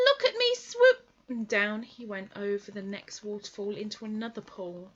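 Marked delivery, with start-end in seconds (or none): 0.0-1.0 a tiny joey showing off; squeaky Roo. Acted. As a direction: Roo calling from the water, squeaky and proud. Roo's voice held.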